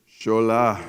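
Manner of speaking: lightly voiced Sierra Leonean dad; dad's voice. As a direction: call his name the way Dad does. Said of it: "like, I got his voice down".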